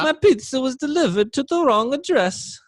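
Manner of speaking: a whiny caller who lost a pizza; mock high caller voice.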